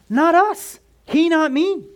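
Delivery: "us" and "me" thrown up high and loud; emphatic contrast. Shown as a demonstration. Preacher driving home that he was pierced, not us, in a loud high voice. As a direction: ring out the contrast.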